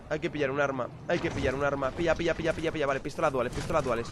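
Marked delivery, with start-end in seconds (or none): none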